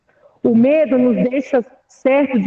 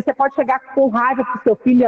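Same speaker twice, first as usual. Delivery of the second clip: normal talking voice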